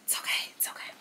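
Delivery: breathy whisper, soothing herself; whispered self-reassurance. Real, not acted. Frustrated creator calming herself down, whispering. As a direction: whisper it to calm yourself down.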